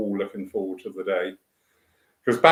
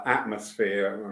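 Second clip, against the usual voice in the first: ordinary delivery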